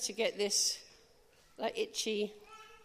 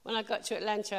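ordinary talk

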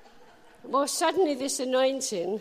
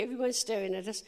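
ordinary talk, nothing marked

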